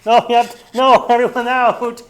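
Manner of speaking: loud, high crowd-control yell; hammed-up fire marshal clearing the room; mock fire marshal shout. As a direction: bark orders at a packed house. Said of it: "acting as the fire marshal".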